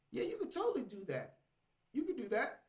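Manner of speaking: high, breezy yes-man voice; mock encouragement. ChatGPT approving a foolish jump, mock encouraging. Voicing ChatGPT agreeing to bad idea.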